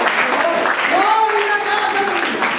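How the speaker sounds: furious yell; angry shouting. Acted. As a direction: shout her out of the house. Son throws the saleswoman out.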